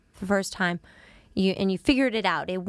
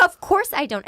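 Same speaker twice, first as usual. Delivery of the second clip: higher, louder indignant retort; retelling her indignant comeback; exasperated; 9 semitones higher, 6 dB louder than usual. Retold or imitated. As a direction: snap back, offended. Exasperated reply to nurses.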